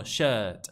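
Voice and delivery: "shirt" with a long schwa and no R. Shown as a demonstration. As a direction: drop the R in "shirt". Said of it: "no dicen la R tan fuerte".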